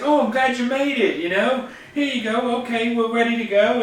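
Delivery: high-pitched put-on welcome; welcoming cafe guy. Cafe guy welcoming a player who wandered in, high-voiced caricature. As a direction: play an eager host in a high voice.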